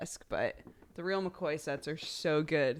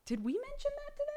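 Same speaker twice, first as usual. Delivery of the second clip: much higher, fast squeaky mock wonder; mock puzzled voice; 6 dB quieter, much faster than usual. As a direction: rattle it off high like a baffled friend. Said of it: "were also going".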